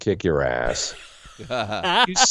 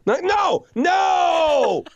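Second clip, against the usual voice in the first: much higher, yelling no in comic dismay; mock horrified shout; 3 dB louder, much slower than usual. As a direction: shout it like you just heard your worst take. Retold horror at his own take.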